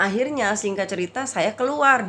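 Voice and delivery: ordinary delivery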